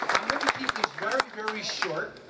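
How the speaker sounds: dropping to a hushed, confiding promise; a quiet promise to the graduates to keep it short; soft aside. Real, not acted. Speaker quietly promising graduates a short speech, hushed.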